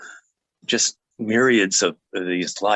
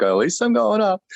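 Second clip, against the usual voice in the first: amused quip; 12 semitones higher than usual. Retold or imitated. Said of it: "And I was like".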